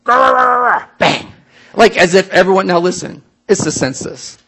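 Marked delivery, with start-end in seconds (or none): 0.0-0.8 growled grr sounds, higher and louder; playful dog-like growl; mock growling. Preacher mocking hostile innkeepers with growls. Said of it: "like this". 0.8-1.3 mock gruff innkeeper turning people away; hammed-up grumpy no, bang, grr; hostile retelling. Innkeepers slamming doors on Mary and Joseph, mock growling. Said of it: "we tell that story ... like this".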